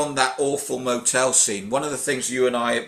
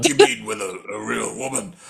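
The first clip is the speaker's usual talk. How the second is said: mocking Stallone. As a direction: squeak the line back in ridicule. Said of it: "what do you make of that statement".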